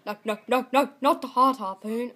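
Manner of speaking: loud, rapid string of refusals; panicked protest. Real, not acted. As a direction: protest loudly at the wrong pick. Picked wrong level by mistake.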